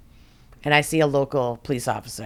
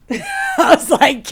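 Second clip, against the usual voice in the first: high laughing aside; 12 semitones higher, 5 dB louder, much slower than usual. Real, not acted. Laughing while telling of mom's recording, high and amused. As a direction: laugh it out, delighted.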